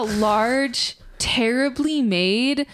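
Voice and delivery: drawn-out, deliberate correction; measured pace, weighting every adjective; slow, emphatic description. Real, not acted. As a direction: spell out each absurd detail slowly.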